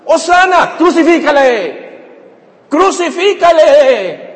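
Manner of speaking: high, loud imitated crowd cries; crowd shouting. Preacher replays the crowd's sudden turn, crowd shouting. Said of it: "ahora están gritando".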